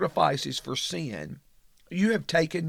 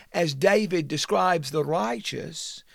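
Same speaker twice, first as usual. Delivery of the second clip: ordinary talk, nothing marked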